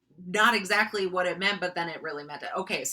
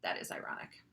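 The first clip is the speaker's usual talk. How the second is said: soft low whisper; hushed aside; 11 semitones lower, 10 dB quieter, faster than usual. Real, not acted. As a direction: whisper it as the punchline. Whispered conclusion.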